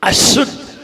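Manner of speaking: अशुद्ध with a strong hissed "sh"; short "a", then whistle hard into अशुद्ध; whistling sibilant. Shown as a demonstration. Teacher demonstrates the opposite word, whistling अशुद्ध. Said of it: "अब अशुद्ध बोलिए".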